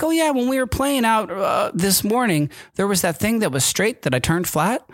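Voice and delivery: put-on high, eager little-kid voice; child voice. Dad imitating his son recalling the morning, high child voice. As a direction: imitate the boy remembering, high.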